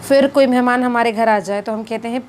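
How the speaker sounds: ordinary delivery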